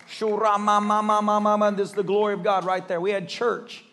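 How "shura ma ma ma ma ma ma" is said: imitating speaking in tongues; a retold burst of praying in tongues. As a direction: rattle off a quick run of tongues. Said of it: "we're sitting there".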